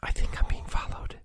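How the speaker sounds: ordinary delivery, nothing marked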